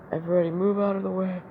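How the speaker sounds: high, fast acted call; acted urgent call. Hero warning everybody before he attacks, urgent acted call. Hero clears crowd in retold fight.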